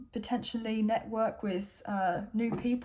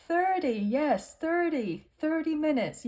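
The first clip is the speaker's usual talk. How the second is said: put-on slow, higher American Irish accent; weird American Irish accent; 4 semitones higher than usual. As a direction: draw out "thirty" in a mock Irish lilt. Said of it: "weird American Irish accent".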